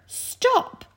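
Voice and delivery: high, loud shouted order; loud, high-pitched witch's command; witch's sharp command. A narrator's reading. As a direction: call a sudden halt as the witch. Witch orders the sledge to halt.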